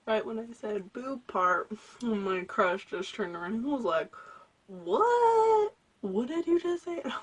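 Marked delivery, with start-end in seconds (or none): 4.7-7.0 high, loud startled what; surprised demand. Retold or imitated. The crush overhearing boob part, startled and loud. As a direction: snap round in disbelief.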